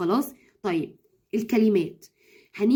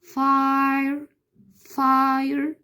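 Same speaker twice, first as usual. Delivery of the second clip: فاير stretched very slowly, higher; drawn-out demo; 4 semitones higher, much slower than usual. Shown as a demonstration. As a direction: pull out the long i in فاير. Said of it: "في فاير في لونج فاول اي".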